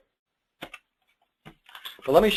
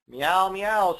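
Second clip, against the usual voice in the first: higher playful meow meow; cat meow; 5 semitones higher, 3 dB louder than usual. Acted. Mom reading the cat line, a light high meow. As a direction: mew like a kitten for a child. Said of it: "says the cat".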